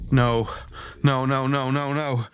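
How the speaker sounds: mild rising panic; panicked denial. Acted. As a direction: let a touch of panic lift the pitch. Ethan finds Ford's body lifeless.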